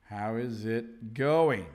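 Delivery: "How is it going" drawn out slowly, "is" kept separate; slow full form. Shown as a demonstration. Teacher repeating a greeting for learners, slow and careful. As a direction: say "How is it going" word by word, low. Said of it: "which is the same as".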